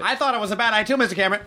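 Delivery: lightly voiced yes-man, pitched high; mock sycophant in a high voice; toadying underling. Mimics a toadying underling to Cameron.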